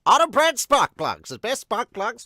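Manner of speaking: loud, high mock ad announcer; half-assed announcer impersonation. Host demonstrating his pro-bending announcer, half-assed announcer voice. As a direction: pitch it like a hammy arena announcer. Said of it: "my half-assed impersonation of the, uh, announcer".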